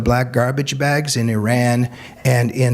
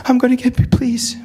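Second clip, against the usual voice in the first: much higher, timid pleading voice; meek young woman; 11 semitones higher than usual. Retold or imitated. Hijabi girl begging the speaker to delete her photo, high timid plea. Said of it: "The hijabi girl".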